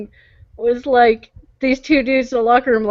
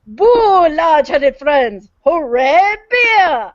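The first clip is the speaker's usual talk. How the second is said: hammed-up ad voice; 3 semitones higher, much slower than usual. Retelling a beer commercial to friends, mock cheer.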